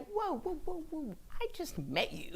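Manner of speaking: high, rushed whoas of alarm; startled whoa. Retold or imitated. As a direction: back off, surprised. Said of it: "I'm like".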